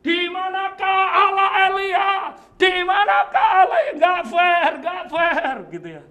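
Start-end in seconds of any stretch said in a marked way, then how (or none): 0.0-3.8 full-throated high shout, louder each time; anguished yell at God; desperate shout. Retold or imitated. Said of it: "Dia teriak gini". 3.9-5.5 loud, high indignant complaint; shouted "nggak fair" twice; outraged protest. Retold or imitated. Elisa's protest, acted.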